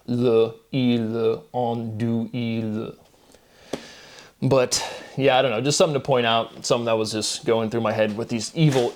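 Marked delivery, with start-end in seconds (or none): 0.1-2.9 "le, il, on, do, il" as separate sounds; built back syllable by syllable. Shown as a demonstration. Showing last syllable first, built back syllable by syllable. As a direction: sound out "le, il, on, do, il" one by one. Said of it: "build back the pr-, or the pronunciation".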